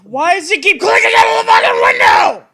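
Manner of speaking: furious high yell; angry shout. Real, not acted. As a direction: scream at the broken computer. Frustrated at computer misbehaving.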